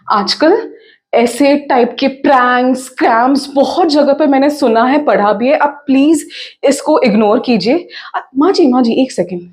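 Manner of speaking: loud, fast, stammering reassurance; flustered daughter-in-law. Acted. Daughter-in-law urging in-laws to ignore the crash call, flustered. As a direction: talk over them urgently, pitch jumping on each address.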